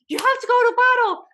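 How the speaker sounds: high, loud urgent call to go onstage; urgent colleague. Retold or imitated. Karen telling the panicked dancer to get onstage, high and loud.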